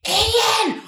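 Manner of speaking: "Ian!" hissed high and loud; a loud stage whisper that gives you away; whisper yell. Shown as a demonstration. Claire's failed secret call, a high whisper-yell. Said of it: "As opposed to going".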